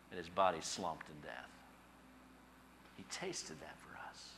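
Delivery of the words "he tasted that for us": near-whisper, much softer and lower; hushed reverence. Real, not acted. Preacher to congregation on Christ's death, hushed. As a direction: drop to a solemn whisper.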